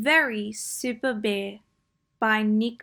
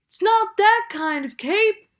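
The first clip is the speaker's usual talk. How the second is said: Bear's voice, louder and higher; yelling; 11 semitones higher, 6 dB louder than usual. Acted. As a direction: call it out to the treetops. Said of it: "yelled Bear".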